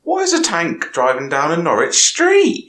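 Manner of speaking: put-on thick Norfolk accent, pitched high. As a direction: lay the Norfolk accent on heavily. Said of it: "I'll trump it on a bit more".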